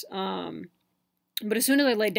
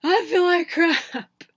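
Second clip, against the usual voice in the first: higher, louder and slow, moaning it out; miserable groan; 9 semitones higher, 8 dB louder, much slower than usual. Retold or imitated. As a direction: drag the words like you feel awful. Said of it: "just like".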